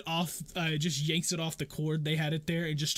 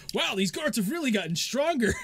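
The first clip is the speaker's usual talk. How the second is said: muffled voice; 8 semitones higher, 5 dB louder than usual. Acted. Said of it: "the muffled voice from inside the barrel".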